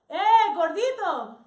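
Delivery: loud, high, drawn-out call; big stretched-out shout; street vendor's yell. Retold or imitated. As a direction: holler it like a vendor across the street. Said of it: "A man in a thatched roof hut selling nieves yells out".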